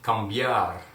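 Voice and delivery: cambiar spoken clearly, stress on -biar; model pronunciation. Shown as a demonstration. Teacher says cambiar for students to repeat. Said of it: "repeat after me".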